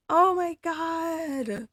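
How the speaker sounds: high, slowly drawn-out astonishment; stunned disbelief. Real, not acted. Shopper reeling from the watch price, stunned.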